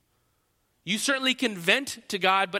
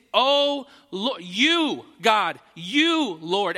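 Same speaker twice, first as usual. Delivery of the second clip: halting, louder cry of "Oh", "you", "Lord"; emphatic calling out; 5 dB louder, much slower than usual. Shown as a demonstration. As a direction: grope for words, calling on God. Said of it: "No, he's saying".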